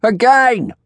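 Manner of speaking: high, loud demand; bold calling out. A narrator's reading. Bold onlooker demanding a repeat, calling out. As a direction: shout it from the crowd. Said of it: "one of the bolder spirits called out".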